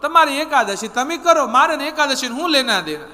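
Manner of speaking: put-on defiant boy. The boy telling the sadhu he won't fast, high and defiant.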